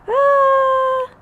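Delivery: long high strained uh; exaggerated confused groan. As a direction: groan in mock bewilderment. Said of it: "confused by jumbled phrase".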